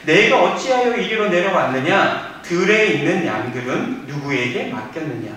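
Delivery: loud, emphatic Bible reading; preacher reading scripture aloud with force. Shown as a demonstration. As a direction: proclaim the passage to the congregation. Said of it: "이 부분은 제가 한번 읽어드릴게요".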